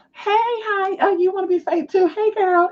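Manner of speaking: very high sing-song greeting; fake friendly. Greeting a fake neighbour back, fake friendly. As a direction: put on a syrupy high hello. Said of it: "So you still play the role".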